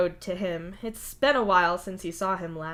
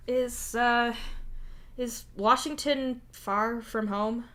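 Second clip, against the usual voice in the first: slow, hesitant, higher question; a little wary; 4 semitones higher, slower than usual. Acted. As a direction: ask carefully, feeling your way. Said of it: "perhaps a little wary".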